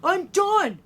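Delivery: loud, high-pitched retort; heated and defensive; upset retort. A narrator's reading. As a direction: fire back at the coach, fed up. Scolded bully answers back.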